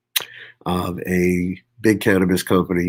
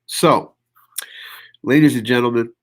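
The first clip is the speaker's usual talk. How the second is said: normal talking voice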